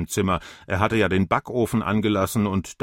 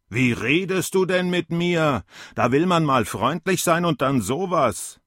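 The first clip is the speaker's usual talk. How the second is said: put-on higher, a touch offended old man; mildly hurt and indignant; 7 semitones higher than usual. A narrator's reading. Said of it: "Der Alte war empört und beleidigt". (empört, leicht beleidigt)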